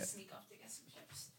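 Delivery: soft unvoiced whisper. Real, not acted. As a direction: whisper so the mic won't catch it. Whispering off mic mid-podcast.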